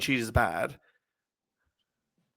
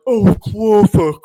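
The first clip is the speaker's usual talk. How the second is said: louder, slow, higher mock dude voice; mock straight guy; 6 semitones higher, 9 dB louder, slower than usual. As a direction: ham up a smug bro laughing at a joke. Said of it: "the straight male's like".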